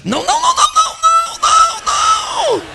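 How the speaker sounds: very high, screeched repeated no; frantic protest. Acted. Spouse cutting off a surrender in argument, shrieked protest. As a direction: squeal a stream of hammed-up refusals.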